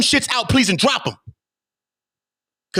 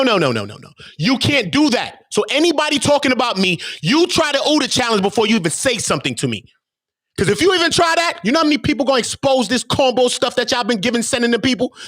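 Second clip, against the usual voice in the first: ordinary talk, nothing marked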